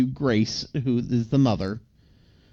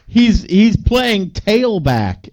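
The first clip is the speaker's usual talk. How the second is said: high, loud and slow, bursting with pride; proud boast; 8 semitones higher, 6 dB louder, much slower than usual. Retold or imitated. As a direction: announce it like a proud dad. Proud dad boasting at office.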